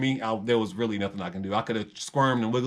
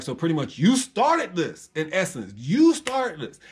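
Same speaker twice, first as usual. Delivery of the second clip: accusing; 5 semitones higher, slower than usual. Retold or imitated. Retold confronting roommate angrily.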